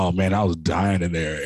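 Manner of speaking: ordinary delivery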